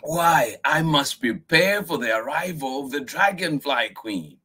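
put-on high, eager child voice; little girl voice. Miyuki explaining her plans to grandfather. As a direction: announce an important task. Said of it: "Miyuki said".

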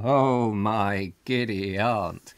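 drawn out, amused; slow amused exclamation. Real, not acted. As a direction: savour each word slowly.

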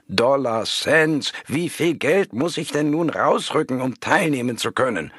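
impatient caller insisting on paying; insistent shout. A narrator's reading. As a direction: demand the price, raising the pitch. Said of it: "schrie sie mich an".

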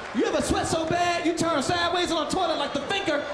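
ordinary talk, nothing marked

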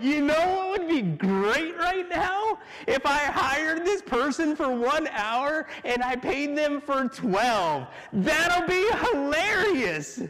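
slow, high, gleeful plotting; drawn-out delight at a secret prank; scheming owner. Acted.

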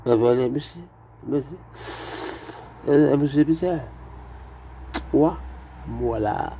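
high, lilting French imitation; romantic mock French. Comic showing French as a romantic language, mock French accent. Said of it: "it's a romantic language".

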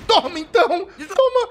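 high, laughing victory yell; crowing over the kill with a laugh; gleeful cheer. Real, not acted. Fan celebrating a kill on stream, laughing and yelling high.

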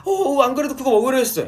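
very high, loud squealed reply; shrill thrilled fan voice; excited fan. Retold or imitated. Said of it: "너무 많이 따라했네".

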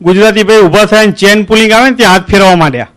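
normal talking voice

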